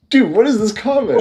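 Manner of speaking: high, incredulous outburst; amused disbelief. Real, not acted. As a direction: react in high-pitched disbelief.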